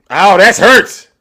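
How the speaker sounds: hammed-up loud yelp of hurt; mock pain. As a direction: pretend his words sting. Mocks pain from muted rant.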